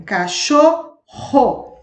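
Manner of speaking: cachorro said slowly, its rr a strong throaty h-like sound. Shown as a demonstration. Teacher showing pupils the strong rr sound, slowly. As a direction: drag out cachorro to show the strong rr.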